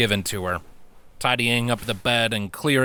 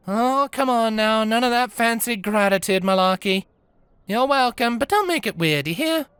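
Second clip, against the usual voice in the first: high, teasing, brushing-off voice; dismissive mother; 12 semitones higher than usual. Acted. Diana waves off Tukey's thanks, teasing and dismissive. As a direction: wave off the thanks with gruff affection.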